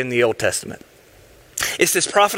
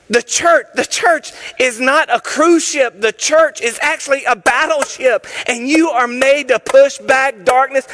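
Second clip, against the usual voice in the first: high, loud, shouted exhortation; rally the congregation to fight; full-force preaching; 8 semitones higher, 3 dB louder than usual. Real, not acted. Preacher rallying the church to keep striking the ground.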